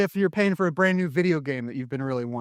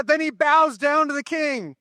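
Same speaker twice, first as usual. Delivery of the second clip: usual speaking voice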